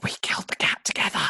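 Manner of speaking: fully whispered, quick; proper conspiratorial whisper with no voice. Acted. Reminds Katie of their secret, whispered. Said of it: "whisper a bit more like a whisper".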